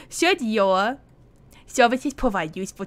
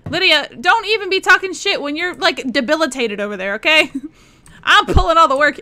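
higher, louder playful telling-off; mock scolding; 5 semitones higher, 7 dB louder than usual. Streamer scolding lazy companion Lydia, mock annoyed. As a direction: scold her with fake outrage.

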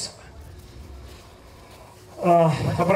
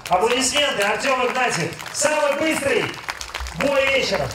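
boxing announcer; 4 semitones higher than usual. Acted. Announcing winner.

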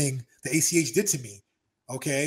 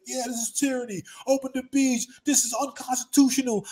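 mocking protester; 8 semitones higher than usual. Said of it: "another one of our boys with him is like".

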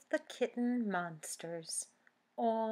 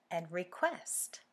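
ordinary delivery

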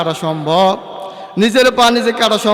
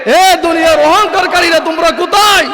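higher, booming challenge; stern calling out; 7 semitones higher, 4 dB louder than usual. Retold or imitated. Allah calling out to the proud of the world, stern shout. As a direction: call out to the proud ones from on high. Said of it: "কঠিন আকৃতিতে বলবে".

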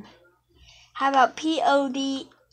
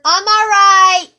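loud call, building louder each time; shouting; 4 semitones higher, 16 dB louder than usual. Real, not acted. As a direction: yell it out, getting louder. Calling out repeatedly.

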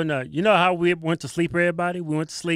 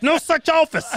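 loud burst of laughter through the words; laughing shout; 11 semitones higher, 7 dB louder than usual. Real, not acted. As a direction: shout it while cracking up. Mocking a fake title.

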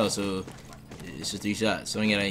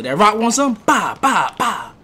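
loud, high taunting with gun noises; mock taunt; 12 semitones higher, 9 dB louder, slower than usual. Gamer taunting a dead player, loud and high. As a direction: taunt the target, then spit out mock gunfire.

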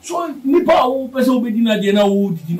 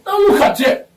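sudden high, louder cry of look at it; exclaiming; 12 semitones higher, 4 dB louder than usual. Real, not acted. Politician urging the crowd to look at rivals' folly, high excited exclaim. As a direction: jump up in pitch to point it out.

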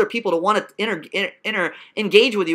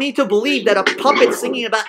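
ordinary talk, nothing marked

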